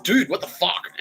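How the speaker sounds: high, loud retold outburst; imitating an exasperated yell; exasperated protest. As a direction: protest in loud, acted disbelief. Retells own protest to bandmate.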